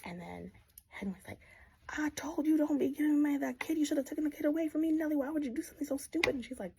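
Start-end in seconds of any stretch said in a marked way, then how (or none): 1.9-6.4 higher, louder fast scolding; mock angry scolding. Drunk father yelling at Nellie, fast and loud. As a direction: tear into her, fast and loud.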